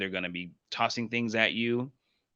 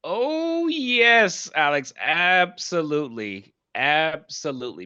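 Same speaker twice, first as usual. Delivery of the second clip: much higher and louder, thrilled; excited agreement; 7 semitones higher, 5 dB louder, slower than usual. Real, not acted. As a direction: burst out in delighted agreement. Enthusiastic agreement with host.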